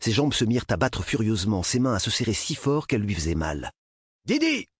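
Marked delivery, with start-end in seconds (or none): 4.2-4.7 high-pitched urgent call; doctor's yell. A narrator's reading. Doctor calls the nurse as the child convulses, yelling. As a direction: yell out for help in alarm. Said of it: "hurla le docteur".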